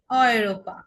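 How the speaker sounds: "অয়রোপা" opening on a clear "অয়". Shown as a demonstration. Teacher giving an eu example, "অয়" sound first. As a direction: lean on "অয়" at the start of "অয়রোপা". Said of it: "অয়".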